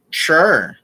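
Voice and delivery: ordinary delivery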